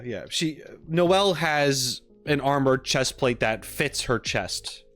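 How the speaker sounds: ordinary talk, nothing marked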